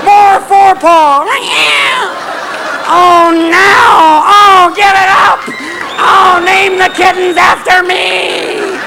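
very high, drawn-out ecstatic yowl; cat in heat. Acted. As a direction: yowl each phrase out in hammed-up ecstasy. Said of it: "Sitting under there going".

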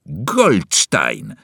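loud spiteful shout; boy's yell. Acted. Boy yelling at Winston before the door slams. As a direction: hurl the name as an insult. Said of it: "wrzasnął malec".

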